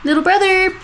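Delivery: teasing nickname. Real, not acted.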